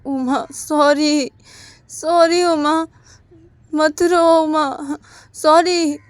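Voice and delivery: slow, high, loud begging; husband begging his crying wife; pleading apology. Retold or imitated. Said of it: "knock करते करते बोलते".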